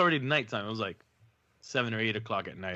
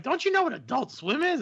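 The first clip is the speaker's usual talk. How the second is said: much higher mock teen disbelief; girls' disbelief. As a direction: tease him like a know-it-all kid. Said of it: "they're like".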